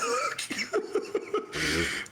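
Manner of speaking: high, breathless cackle; laughter. Real, not acted. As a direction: crack up helplessly. Cracks up at his own story.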